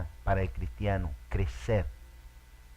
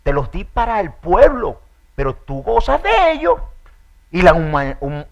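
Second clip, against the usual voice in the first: much louder and higher stern rebuke; authoritative scolding; 6 semitones higher, 14 dB louder than usual. Acted. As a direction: scold a complaining servant with full authority. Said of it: "sino con autoridad". (Dios regañando con autoridad, a gritos)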